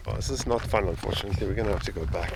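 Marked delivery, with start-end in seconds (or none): none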